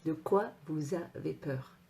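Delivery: DE QUOI VOUS AVEZ PEUR spaced out slowly; measured delivery, letting each word sink in; slow, pointed question. Shown as a demonstration. Coach challenging viewers who don't dare, slow and pointed.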